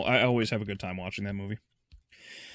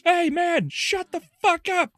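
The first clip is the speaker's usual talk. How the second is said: high, loud mock shout from afar; annoyed bystander yelling; 4 dB louder, slower than usual. Someone who doesn't care yelling at the bad guy, mock angry shout. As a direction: yell back like an irritated neighbor. Bystander yells back at the villain.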